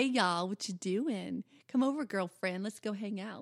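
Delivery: playful Southern belle drawl; Southern belle accent. Shown as a demonstration. Wife showing husband her best Southern belle accent. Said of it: "Do your best Southern Belle accent".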